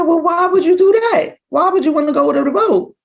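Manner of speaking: high, loud, incredulous why; disbelieving question. Retold or imitated. Mother hearing her son wanted the boat, high and incredulous. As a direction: act stunned at the son's choice, voice shooting way up.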